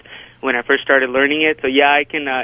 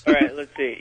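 ordinary talk

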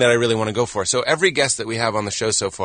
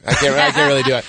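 ordinary talk

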